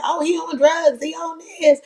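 high, mocking dismissive voice; mocking panel. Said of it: "they're like".

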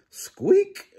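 very high squeak; tiny mouse squeak up high. A narrator's reading. Mouse noise in story.

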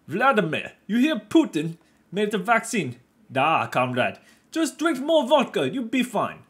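put-on joking Russian accent, laying it on thick and loud; bad Russian accent. Vlogger jokingly plays a Russian hearing about Putin's vaccine, hammed-up Russian accent. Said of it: "Sorry, bad Russian".

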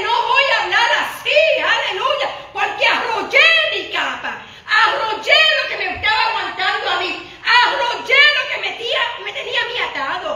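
loud, high, impassioned preaching with bursts of laughter; fiery preaching. Acted. Pastor rousing the church to roll up their cloak, loud preaching. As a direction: preach it out to the congregation, full force.

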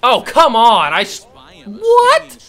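loud, high disbelieving cry; frustrated yell. Real, not acted. Batter missing a pitch he expected, yelling. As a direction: yell at the screen, stunned. Swung and missed.

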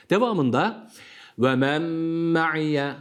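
normal talking voice

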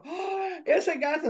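high gasp, then startled cry; frightened children. Acted. Little mice looking up at the cat, frightened. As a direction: gasp and squeak in mock alarm.

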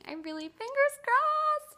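high, drawn-out squeal of hope; real hope, squeaked up high; hopeful. Real, not acted. Hoping to get the job.

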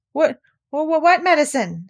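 small nervous high voice; alarmed stammer. A narrator's reading. Piglet alarmed at medicine.

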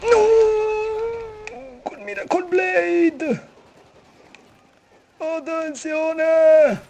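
high, loud, drawn-out panicked cry; explosive italian cursing. Real, not acted. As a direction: wail it out in a panic. Frantic gaming panic.

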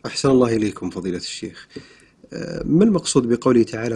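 ordinary delivery

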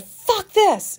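sudden loud, high curse of frustration; frustrated outburst. Retold or imitated. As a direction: snap it out, fed up and done. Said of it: "a good portion of people heard me".

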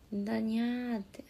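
hushed Yamagata cat-speak; cat-like Yamagata dialect. Shown as a demonstration. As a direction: quietly repeat the meowing Yamagata ending. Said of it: "ガチで言うからね、".

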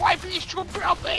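high shrieking disgust; disgusted shouting. Real, not acted. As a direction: shriek in revulsion. Gross enemy attacks.

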